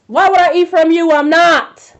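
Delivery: loud indignant refusal. Retold or imitated. Refusing a stranger's homemade sweets, loud and indignant. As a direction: throw the question back, half shouting.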